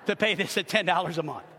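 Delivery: ordinary talk, nothing marked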